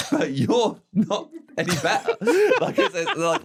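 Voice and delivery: real laugh bursting through the words. Real, not acted. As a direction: crack up as you land the joke. Laughing at his own point.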